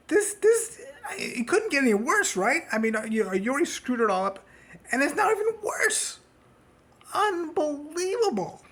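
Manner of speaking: high, crying-out despair; exasperated wail. Real, not acted. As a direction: whine it like a fed-up fan near tears. Exasperated fan despairing at team.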